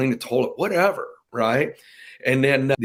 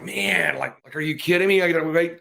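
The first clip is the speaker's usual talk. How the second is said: higher, lightly acted incredulous kid; mock exasperation; 7 semitones higher than usual. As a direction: play a kid who can't bear waiting. Retells impatient kid's reaction.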